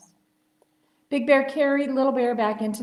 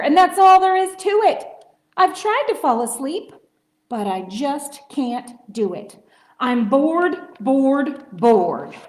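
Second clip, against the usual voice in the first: loud, higher frustrated bear rant; grumpy roaring bear; 5 semitones higher, 9 dB louder than usual. Acted. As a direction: vent it like a cranky sleepless bear. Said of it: "roars Bear".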